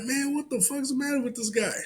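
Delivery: very high-pitched retold disbelief; incredulous. Worker seeing the mess by the hotel, incredulous. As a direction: squeal it in mock bewilderment. Acted disbelief at the man's behaviour.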